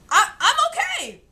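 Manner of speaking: mock cheerful denial; squeaky reply. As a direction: squeak out a fake I'm fine. Her own answer, quoted.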